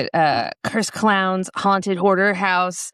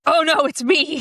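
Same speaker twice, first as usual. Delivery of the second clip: mock panic; 10 semitones higher than usual. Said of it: "I was like".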